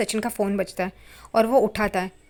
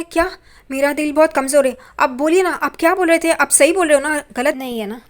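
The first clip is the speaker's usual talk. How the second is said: higher and much louder, stunned and pleading; can't believe the news, begs to hear it again; overwhelmed shock with a weak heart; shocked disbelief; 6 semitones higher, 10 dB louder than usual. A narrator's reading. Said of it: "Sachin एकदम हैरान हो जाता है".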